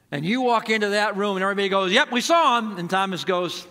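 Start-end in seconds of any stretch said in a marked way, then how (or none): 1.9-2.8 excited. Retold or imitated. Everybody tells Thomas they saw him.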